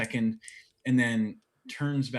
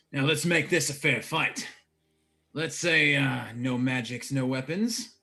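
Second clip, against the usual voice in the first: raised, loud fighting talk; challenging brawler; 5 semitones higher, 6 dB louder than usual. Acted. Brawler stepping up with fists raised, challenging. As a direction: lay down the rules loudly. Game master voices brawler setting rules.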